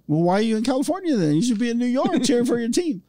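put-on a kid scolding a grown-up; indignant kid. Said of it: "I'm like".

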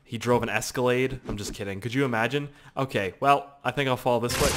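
ordinary talk, nothing marked

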